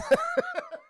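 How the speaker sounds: real laugh, squealing high; high burst of laughter. Real, not acted. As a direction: crack up laughing. Laughs at joke.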